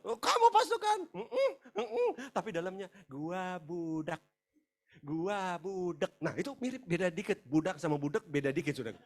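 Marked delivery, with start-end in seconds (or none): 0.1-2.1 high, put-on show-off voice; sok pasukan. Meniru percakapan sok menjadi pasukan. 3.1-6.1 very slow inner confession; pengakuan pelan. Acted. Mengaku isi hati yang sebenarnya.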